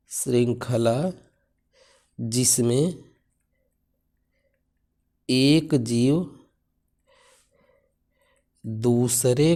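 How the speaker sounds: very slow, long gaps between words; dictating word by word; slow dictation. Shown as a demonstration. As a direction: pace it for note-taking. Teacher dictating definition slowly.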